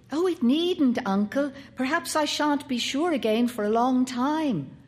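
put-on a keen little boy pleading with his uncle; boy Paul. A narrator's reading. Narrator voices the boy Paul.

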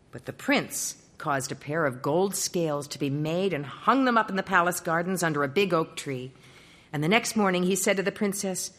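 ordinary delivery, nothing marked

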